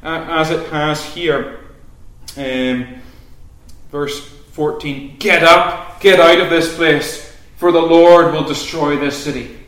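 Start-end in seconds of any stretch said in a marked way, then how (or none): 5.2-9.6 loud warning cry, as Lot to his family; urgent cry. Acted. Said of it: "cried out to them".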